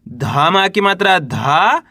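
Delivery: very slow, धा held and rising; spelling aloud. Shown as a demonstration. Teacher sounding out the third letter, slow with long धा. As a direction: spell it out, stretching धा upward. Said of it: "चलिए देखिए".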